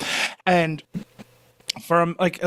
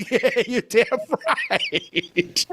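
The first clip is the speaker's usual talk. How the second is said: mock tough answer through a laugh; laughing retort; 5 semitones higher, much slower than usual. To the stranger, half-laughing retort. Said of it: "I go".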